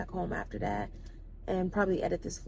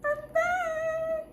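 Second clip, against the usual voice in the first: high squeal of excitement; excited; 4 dB louder than usual. Real, not acted. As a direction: squeal it out, thrilled. Excited return after treatment.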